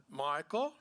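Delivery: imitating an English art dealer; quoting someone in a mock English accent. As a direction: put on a light English accent, rising on the name. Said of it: "this is my English accent".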